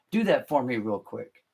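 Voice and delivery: usual speaking voice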